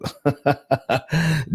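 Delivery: rapid chuckle in a run of short bursts; amused laugh. Real, not acted. Host tickled that David is in both places, laughing. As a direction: laugh it off briefly, softly rippling.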